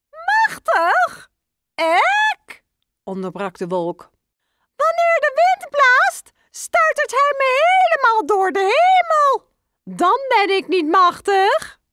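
very high, loud surprised cloud voice; high, loud little cloud protesting. Acted. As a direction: cry out in disbelief, then admit it.

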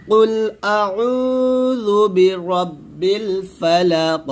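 drawn-out melodic আউযু বিরাব্বিল ফালাক; slow recitation. Shown as a demonstration. Teacher recites the verse for students to echo, slowly. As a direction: intone it slowly, all joined. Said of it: "আবার বলেন".

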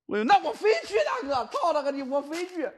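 high, defiant insisting; stubborn insisting. Real, not acted. Streamer defying the ghost's pull, high stubborn insisting. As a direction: refuse to give in, pitch up.

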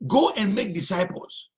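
ordinary talk, nothing marked

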